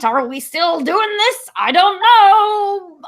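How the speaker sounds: ordinary talk, nothing marked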